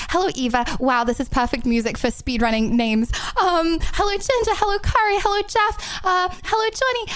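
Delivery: rattling off names high and quick; all in one breath. Real, not acted. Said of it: "Basically all in one breath".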